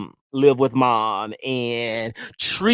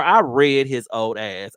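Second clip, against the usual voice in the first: normal talking voice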